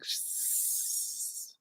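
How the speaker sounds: slow, soft, hissing snake. Acted. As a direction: draw it out like a sly snake. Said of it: "the snake was like".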